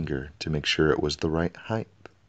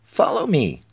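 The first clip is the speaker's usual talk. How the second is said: much higher, louder helpful servant voice; eager treasure keeper; 8 semitones higher, 7 dB louder than usual. A narrator's reading. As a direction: chirp it up as a keen palace servant.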